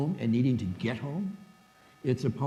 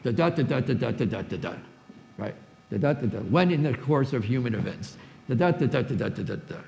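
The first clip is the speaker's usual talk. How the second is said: da da da da tapped out in strict iambic rhythm; iambic beat; 3 dB louder than usual. Shown as a demonstration. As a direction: chant the iambic stresses on each da. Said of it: "iambic pentameter".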